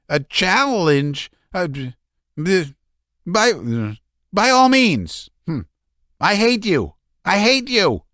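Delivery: high, strained, halting; stammering hatred. A narrator's reading. Laevsky trembling with hatred.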